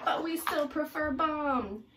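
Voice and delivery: stubborn, stress on still; cheeky piranha. Acted. Piranhas reply.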